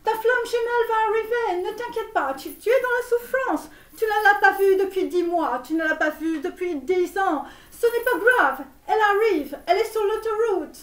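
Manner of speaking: high-pitched, insistent mock reassurance; relentlessly positive. Shown as a demonstration. Tarot reader promising the twin flame is coming, relentlessly positive. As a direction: keep pitching it up like upbeat guru patter. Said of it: "tu ne dis que les choses positives".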